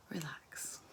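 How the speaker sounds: hushed, breathy release; relaxing cue. Shown as a demonstration. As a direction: let the word sink into a near whisper. Leading relaxation exercise.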